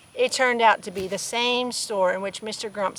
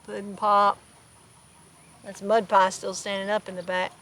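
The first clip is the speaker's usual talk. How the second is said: ordinary delivery, nothing marked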